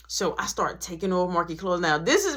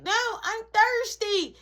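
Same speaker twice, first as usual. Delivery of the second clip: put-on kid brother voice; 12 semitones higher, slower than usual.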